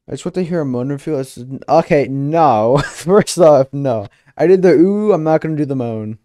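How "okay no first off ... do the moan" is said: loud, laughing no; laughing refusal. Real, not acted. Streamer laughing off chat's request, loud. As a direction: laugh it off loudly.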